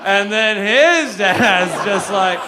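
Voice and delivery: "his" stretched long with a swooping rise; drawn-out stress on HIS. Real, not acted. Comic going back another generation of dads, "his" loudly stretched. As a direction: lean hard and loud on "his", dragging it out. Running bit, escalating lead-in.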